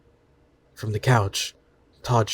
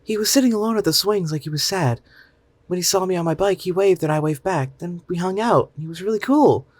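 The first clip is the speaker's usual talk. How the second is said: put-on high, bright little boy voice, a bit louder; child voice; 9 semitones higher, 4 dB louder than usual. Mother voicing her son explaining how he met Todd, high child voice. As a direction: voice the young son telling his story. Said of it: "Mason told me".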